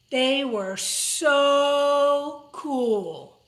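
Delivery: stretched out very slowly in awe; dreamy awe. Acted. Kid bean gazing at the cool beans, slow awe. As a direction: let each word hang, dazzled.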